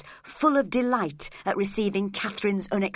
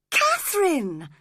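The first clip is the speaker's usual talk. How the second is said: "Catherine!" cried out higher and louder; shocked cry; 10 semitones higher, 4 dB louder than usual. Acted. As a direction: call "Catherine!" in shock, pitched up. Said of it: "cried Edgar, shocked".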